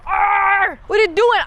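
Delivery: annoyed shout at the TV; exasperated shout. Retold or imitated. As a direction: bark it at the screen in frustration. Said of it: "I go".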